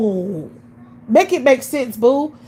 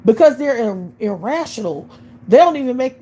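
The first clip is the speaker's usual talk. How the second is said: ordinary delivery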